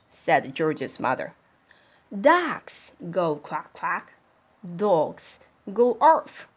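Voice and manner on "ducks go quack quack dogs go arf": slow, deliberate explaining; slow teaching. Acted. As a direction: lay it out word by word. Mother teaching slowly.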